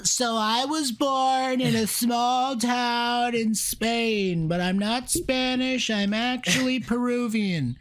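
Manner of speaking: high, slow, hammed-up old man telling his life story; mock life-story narrator. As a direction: stretch it out like an old man's memoir. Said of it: "You don't sound Peruvian".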